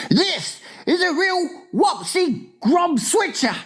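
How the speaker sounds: very high, emphatic cry; hammed-up horror at the catch; alarmed giant. A narrator's reading. Giant cries about the nightmare.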